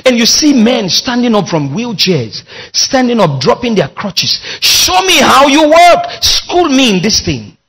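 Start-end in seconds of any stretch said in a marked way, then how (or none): 4.6-7.6 urgent plea. Acted. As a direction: cry it out like a hungry believer. Preacher's rising plea.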